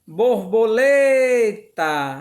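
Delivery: "borboleta" half-sung, with LE held long and loud; sing-song syllable stress. Shown as a demonstration. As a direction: chant "borboleta", stretching LE right out. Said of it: "quando a gente lê cantando". ("borboleta" cantado, alongando bem o LE)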